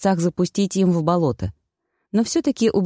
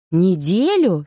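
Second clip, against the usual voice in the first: startled question; slower than usual. A narrator's reading. Heroine learns she was gone a week, startled. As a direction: pitch swooping up on НЕДЕЛЮ in disbelief. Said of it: "спохватилась я".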